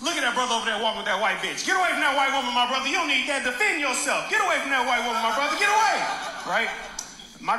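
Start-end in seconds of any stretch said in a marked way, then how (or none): 0.0-5.9 higher, urgent street-preacher hollering, building at the end; mock preacher yelling. Preacher yelling at a man with his girlfriend, mock hollering. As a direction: imitate a preacher yelling a warning. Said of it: "immediately starts yelling at us".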